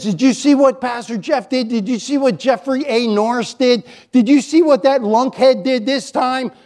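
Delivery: high-pitched, pointed accusation; accusing. Acted. Satan telling on the pastor before God, high and accusing. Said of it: "when he comes and accuses".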